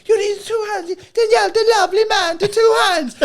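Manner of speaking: squeaky high mock nonna scolding; Italian nonna. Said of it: "like, going".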